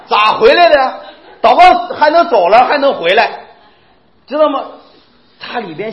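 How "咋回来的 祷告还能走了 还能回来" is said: loud, incredulous laughing question. Real, not acted. Preacher loudly scoffs that prayed-away sickness could return. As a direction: shout it in disbelief.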